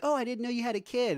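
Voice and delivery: high, surprised neighbor voice; imitating a surprised neighbor; mock neighbor. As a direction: pitch it up like a startled neighbor. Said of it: "neighbors were like".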